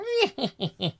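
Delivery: high villainous laugh; hammed-up evil-twin cackle. Evil doppelganger announcing himself, villainous cackle.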